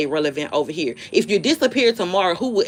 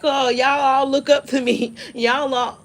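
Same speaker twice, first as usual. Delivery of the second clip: mocking; 7 semitones higher than usual. Mocking show-off poster.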